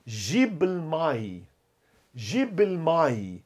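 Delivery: very slow, measured Arabic phrase; drawn-out teaching pace; model for repetition. Shown as a demonstration. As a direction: say it slowly for learners to copy.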